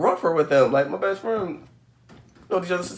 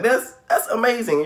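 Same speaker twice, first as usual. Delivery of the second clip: ordinary talk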